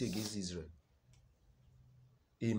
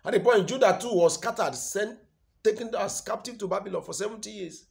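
loud, high pulpit proclamation; ringing, declaimed sermon; full-force preaching; 11 semitones higher, 14 dB louder than usual. Real, not acted. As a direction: proclaim the scripture at the top of your voice.

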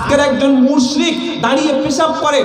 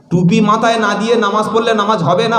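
ordinary delivery

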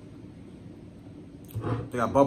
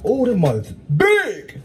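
impressed; 6 semitones higher, slower than usual. Real, not acted. Impressed by size.

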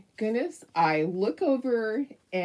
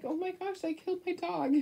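much higher and softer, a mock-despairing wail; retold fear; 11 semitones higher, 4 dB quieter than usual. Remembering thinking she killed her dog, in high mock despair. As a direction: replay the fear of losing the dog. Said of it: "because I was so afraid".

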